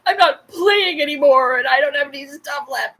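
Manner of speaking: high, tearful whine with a gasp; hammed-up gamer about to burst into tears; mock crying tantrum. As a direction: imitate your own sobbing meltdown. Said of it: "just started burst out crying".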